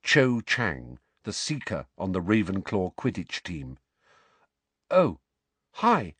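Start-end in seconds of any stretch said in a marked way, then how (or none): 4.9-6.2 blank. A narrator's reading. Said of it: "said Harry blankly".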